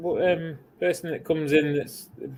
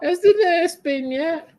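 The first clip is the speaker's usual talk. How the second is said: put-on frail old man voice; 4 dB louder, slower than usual. Playing old man in care home.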